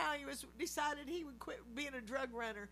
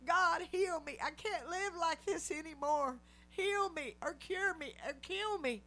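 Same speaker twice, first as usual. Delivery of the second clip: raised, higher pleading prayer; desperate plea; 5 semitones higher, 5 dB louder than usual. Retold or imitated. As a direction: cry out to God for healing. Retold desperate prayer at altar.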